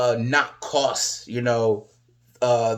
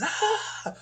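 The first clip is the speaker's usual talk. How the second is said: retold scream; 5 dB quieter than usual. Wrestler caught in an ankle hold, high mock scream. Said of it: "he screams".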